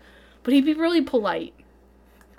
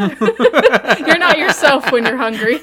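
slow, loud, laughing ad line; mock ad slogan; 8 dB louder, slower than usual. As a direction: deliver it like a candy commercial. Quoting Snickers ad slogan.

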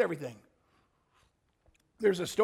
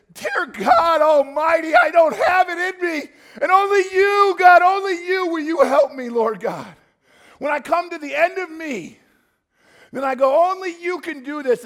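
desperate shouted prayer; 9 semitones higher, 11 dB louder than usual. Acted. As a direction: pour out every need, begging.

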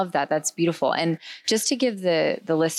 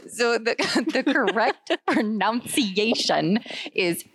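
higher, slow, giggling through the words; laughing; 6 semitones higher, slower than usual. Real, not acted. Co-host retrying "pronunciation" after botching it, laughing. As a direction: fight the giggles to get it out.

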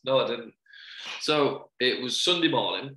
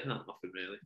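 put-on mumbled teen reply; 5 semitones lower, 14 dB quieter than usual. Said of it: "What have you enjoyed today?".

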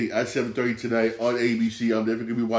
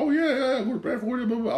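much higher, breezy gushing; eager recommendation; faster than usual. Retold or imitated. Mimicking himself hyping the restaurant, high breezy gush. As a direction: rattle it off like an overeager fan. Mock of his past recommending.

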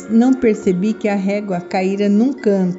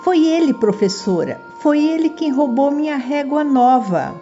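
put-on accusing child; 6 semitones higher than usual. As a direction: point the finger and accuse, high-pitched. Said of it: "Maria Rita acusou apontando com o dedo".

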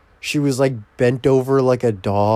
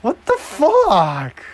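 outraged disbelief. Real, not acted. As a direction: shriek in disbelief. Disbelief at the play.

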